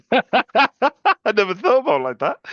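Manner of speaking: giggling imitation of the caller; high laughing mimic.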